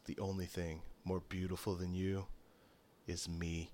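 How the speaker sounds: low, soft and self-satisfied; hushed smug compliment; vain hushed boast. Acted. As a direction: purr it to her like a vain charmer. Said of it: "he goes".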